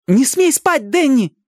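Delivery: high, alarmed order; urgent command. A narrator's reading. Ali keeping Danny from sleeping, urgently. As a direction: forbid it urgently.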